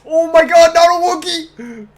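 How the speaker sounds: high, loud panicked yell; panicked shriek. Real, not acted. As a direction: shriek in sudden gamer panic. Ambushed by Chewbacca in game.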